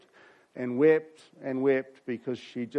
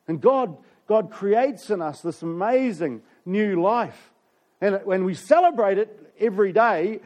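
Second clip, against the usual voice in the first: higher, louder joyful preacher voice; full-force preaching; 6 semitones higher, 5 dB louder than usual. Acted. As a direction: preach it with rising fervour.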